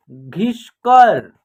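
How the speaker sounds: very slow, each syllable apart; slow clear word demo. Shown as a demonstration. Teacher modelling a new word for children, very slow. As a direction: drag the word out for beginners. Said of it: "देखिए घ में छोटी की मात्रा घी".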